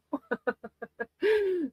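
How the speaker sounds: high bubbly laugh at herself; giggling fit; giggle. Real, not acted. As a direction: laugh off the name mix-up. Laughing at own mispronouncing.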